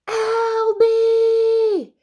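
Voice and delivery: high, drawn-out angry call; loud sing-out yell for a brother. Acted. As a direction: bellow the name across the house. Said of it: "yelled Mary".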